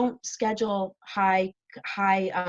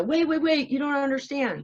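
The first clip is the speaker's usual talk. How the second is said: pleading; 5 semitones higher than usual. Pretend. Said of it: "give me a chance to, like, go".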